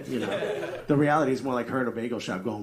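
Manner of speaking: normal talking voice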